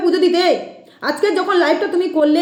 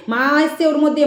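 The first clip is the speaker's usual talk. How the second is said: ordinary talk, nothing marked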